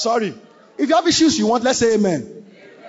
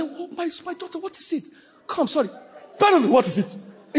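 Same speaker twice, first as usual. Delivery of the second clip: softer, higher, gentle asking; mock tender pastor; tender concern; 5 semitones higher, 5 dB quieter than usual. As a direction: coax a daughter kindly.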